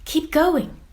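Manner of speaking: high, loud cheer of encouragement; rooting for the little squid; urging. Acted. A friend cheering Squiddy past the sharks, high and loud.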